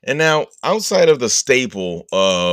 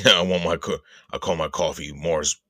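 normal talking voice

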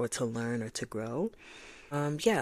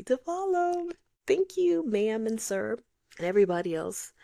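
much higher, sugary polite voice; sweet high voice; 8 semitones higher, 4 dB louder than usual. Acted. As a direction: coo it sweetly.

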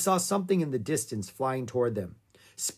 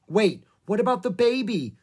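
worried interjection; 8 semitones higher, 5 dB louder than usual. A narrator's reading. Annie alarmed for the calf.

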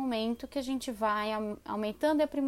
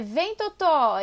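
calling the dog; 3 semitones higher, 6 dB louder, much slower than usual. Shown as a demonstration. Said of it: "outro erro que a gente comete".

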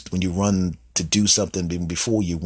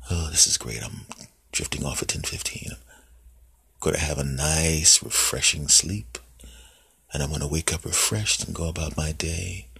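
soft, lower, fading into a whisper; sleepy murmur; 5 semitones lower, 5 dB quieter than usual. Acted. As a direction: drift off as you speak. Drifting off to sleep.

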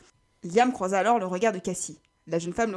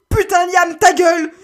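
furieuse; 9 semitones higher, 15 dB louder, slower than usual. Acted. Stacy engueule Liam en pleine douleur.

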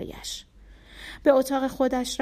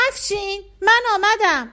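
put-on high, loud calling-out little boy; child shouting; 10 semitones higher, 7 dB louder than usual. A narrator's reading. Bardia calling out to Afshin as he comes home, loud and high. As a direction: shout through the house for his brother. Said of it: "بردیا فریاد زد".

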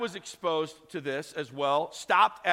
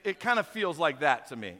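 normal talking voice

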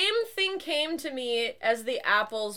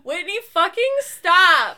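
exasperated yell; 6 semitones higher, 6 dB louder than usual. Real, not acted. Friend farted, she yells.

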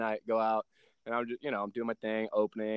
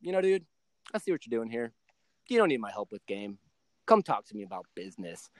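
mentor's verdict; 6 semitones lower, 5 dB quieter than usual. Retold or imitated. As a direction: lower and softer, calm mentor. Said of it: "Derek looked at me, he goes".

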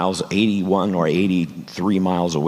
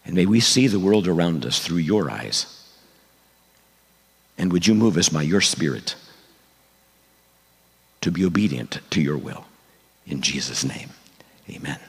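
prayer. Acted. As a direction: pray earnestly to God. Pastor leads a prayer.

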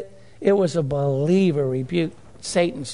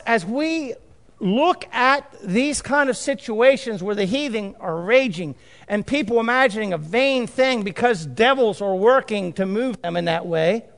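ordinary talk, nothing marked

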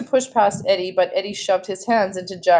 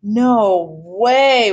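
cried out; 2 semitones higher, 5 dB louder than usual. A narrator's reading. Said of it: "one of the boys cried out".

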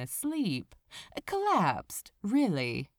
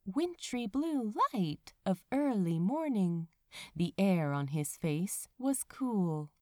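usual speaking voice

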